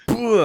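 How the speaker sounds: high, loud burst of a crash; punchy mouth-made impact sound; imitated impact noise. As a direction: blurt out a big thudding boom. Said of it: "you've just kind of gone".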